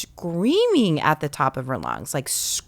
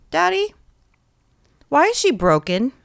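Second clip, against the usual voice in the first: put-on high, innocent child's question; curious small child, pitched up; little boy voice; 8 semitones higher, 4 dB louder than usual. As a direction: play a puzzled toddler asking earnestly. Toddler son voiced in story.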